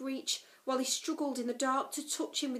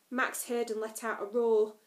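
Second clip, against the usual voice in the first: plain reading voice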